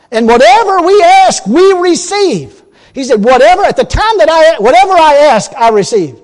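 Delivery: high, loud preacher's proclamation; preaching loud. Acted. Preacher proclaiming the verse to his church, loud and high. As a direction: ring the promise out to the congregation. Preacher quoting scripture at full force.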